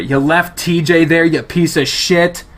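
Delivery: loud angry shout; angry yell. Acted. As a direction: hurl the insult after him. Someone yells after him.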